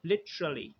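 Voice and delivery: Literally as li-chruh-lee; three syllables in Literally, "chr" in the middle; chr sound. Shown as a demonstration. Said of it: "हम इस तरह कहते हैं". (Literally तीन syllable में: लिच-र-ली)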